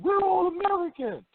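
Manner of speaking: high, hammed-up rallying cry; mock patriotic shout. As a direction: chant it like a flag-waving crowd. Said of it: "Everybody screamed".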